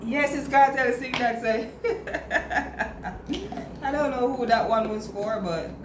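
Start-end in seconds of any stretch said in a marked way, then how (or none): 1.7-3.8 soft unvoiced laugh; breathy laugh. Real, not acted. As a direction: laugh under your breath. Laughs at the joke.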